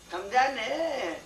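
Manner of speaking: ordinary delivery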